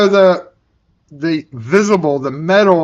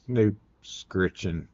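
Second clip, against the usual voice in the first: softer, slower try at the word; soft slow correction; 7 semitones lower, 11 dB quieter, slower than usual. Shown as a demonstration. Correcting himself, softly and slowly. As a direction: say it gently and slowly. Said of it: "Scritching on the snoot".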